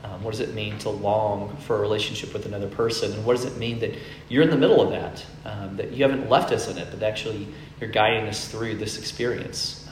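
soft, low opening prayer. Real, not acted. Pastor praying over the congregation, soft and low. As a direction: pray quietly before the sermon.

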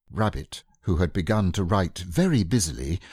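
ordinary delivery

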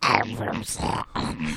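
low, slow Scottish accent; broad Scottish accent, said slowly. Shown as a demonstration. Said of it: "try your, uh, Scottish accent out".

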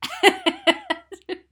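high squealing laugh. Real, not acted. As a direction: break into a shrieking laugh. Laughs at the joke.

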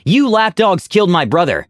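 high-pitched angry accusation; narrated shout. A narrator's reading. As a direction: hurl the blame, volume held in check. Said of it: "one shouted".

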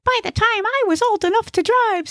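high-pitched, cocksure character voice; very high comic know-it-all; know-it-all Gnesi. Acted. Gnesi dismisses Ig's worry about his driving, high cocksure voice. Boastful driver speaks in story.

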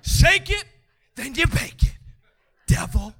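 loud, hammed-up taunts at the devil; trash-talking shout. Preacher telling the devil to go back, mock trash-talking shout. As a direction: shout each taunt, then leave a gap. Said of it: "I've been trash talking the devil".

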